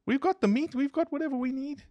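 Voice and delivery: high put-on villager voice; locals' voice. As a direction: play it high and carefree. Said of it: "And they're like".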